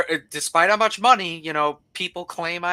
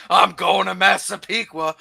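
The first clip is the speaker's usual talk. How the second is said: mock-excited, a bit louder; imitating excitement; 2 semitones higher, 5 dB louder than usual. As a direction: play a man thrilled about his little outing. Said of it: "He's all excited".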